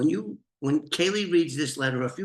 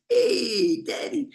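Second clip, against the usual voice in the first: mock daughter greeting; much slower than usual. Father lightly mimics his daughter saying hi. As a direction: chirp it like a daughter. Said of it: "give her some culture with my accent".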